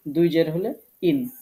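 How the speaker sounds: ইন said slowly with a clear ইন ending; tanbin in sound. Shown as a demonstration. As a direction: stretch out ইন, slow and clear. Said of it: "রস্যিকারের সাথে দন্ত ন". (ধীরে ইন উচ্চারণ)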